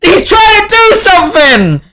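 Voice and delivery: high, panicked yell warning the others; alarmed shout. Acted. Drow spots the trick and yells to his comrades, panicked. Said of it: "And he goes".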